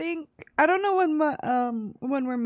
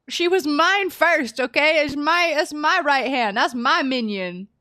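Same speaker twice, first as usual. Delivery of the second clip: high, loud, hammed-up jealous warning; playfully guard what's yours; mock possessive; 5 semitones higher, 7 dB louder than usual. Mock-jealous claim over wife.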